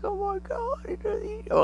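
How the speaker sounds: very high, breathless mock gasp; squeaky amazed alien. Alien whispering about the clueless human, very high squeak. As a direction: squeal it like a tiny amazed creature.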